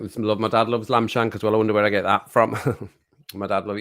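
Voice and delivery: ordinary talk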